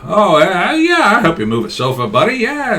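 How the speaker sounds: high, overeager pal agreeing to help; high, chummy yes-man voice; eager buddy. Acted. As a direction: gush yes like a too-keen new friend. Acted friend eagerly agreeing.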